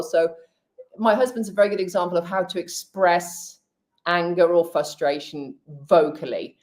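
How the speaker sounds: normal talking voice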